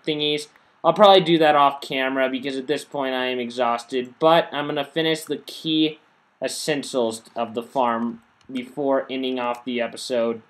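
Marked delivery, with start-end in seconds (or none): none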